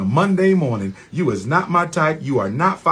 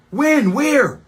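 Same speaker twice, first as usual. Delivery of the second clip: high, loud indignant When? Where?; indignant loud demands; outraged questions; 12 semitones higher, 5 dB louder than usual. Real, not acted. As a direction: fire each question up high. Denying accusation, outraged.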